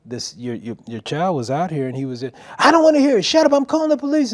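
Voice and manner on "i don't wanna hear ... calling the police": loud, high, hurried frantic shouting; screaming mother. Retold or imitated. Mom cutting off the stranger's explanation, screaming. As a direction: scream over him, refusing to listen. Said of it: "and she's screaming".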